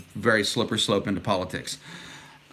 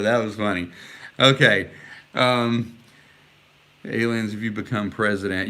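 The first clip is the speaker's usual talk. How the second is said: ordinary delivery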